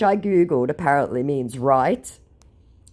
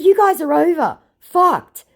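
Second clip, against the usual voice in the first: high, loud gloating jab; mock taunt; 13 semitones higher, 7 dB louder than usual. Gloating at the scammer that he's finished, high and loud. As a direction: crow it at him.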